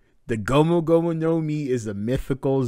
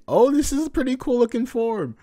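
high playful Luffy voice; lightly high cheerful Luffy; 12 semitones higher than usual. Acted. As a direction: voice the hero grinning. Voicing Luffy's line.

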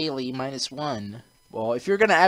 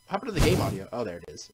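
ordinary delivery

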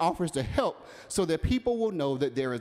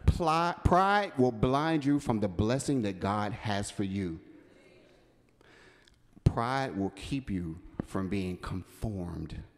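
solemn slow close; 7 semitones lower, slower than usual. Real, not acted. Preacher closing the sermon, slow and solemn. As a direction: let each word fall heavy and slow.

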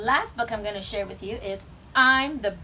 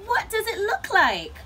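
high, exasperated retort; snapping; 9 semitones higher, 3 dB louder than usual. A narrator's reading. Grumpy Bird retorting to Raccoon, snappish. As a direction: throw the question back irritably. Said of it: "snapped Bird".

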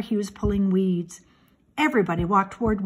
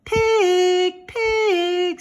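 high, drawn-out pig call; high, kindly singsong call; kind farm call; 9 semitones higher, 6 dB louder than usual. A narrator's reading. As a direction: coax the pig by calling out. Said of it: "said Mister Zuckerman in a kind voice".

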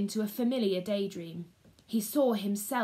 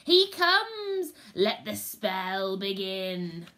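witch voice; 6 dB louder, much slower than usual. Acted. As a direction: announce him, then begin the spell slowly. Third witch continues.

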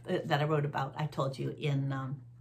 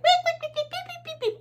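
very high peeping duckling imitation; high chick peeps, imitated; duckling beeps. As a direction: squeak like tiny baby ducklings. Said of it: "a whole bunch of baby ducklings".

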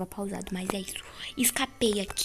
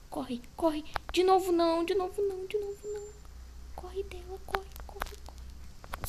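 high, frantic, fading to breath; desperate pleading as she flees; panicking gamer; 8 semitones higher, 5 dB quieter than usual. Real, not acted. Caught again, fleeing.

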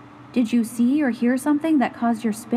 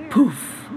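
a playful poof as the worry vanishes; magic poof; 2 semitones lower than usual. Acted. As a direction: blow the worry away.

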